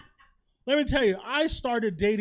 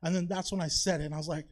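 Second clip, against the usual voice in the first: ordinary delivery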